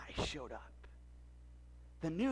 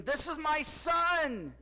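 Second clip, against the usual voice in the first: slow, louder divine proclamation; booming voice of God; 7 semitones higher, 6 dB louder, much slower than usual. Shown as a demonstration. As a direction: announce it from the heavens. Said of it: "See if I can do it".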